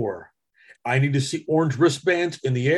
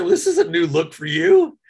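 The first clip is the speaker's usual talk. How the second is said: teasing greeting; 6 dB louder than usual. Retold or imitated. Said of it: "I walked up to her and said".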